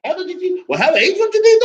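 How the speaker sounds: a parent surprised at the son's new purchases; excited exclaiming. Acted. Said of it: "something you might say".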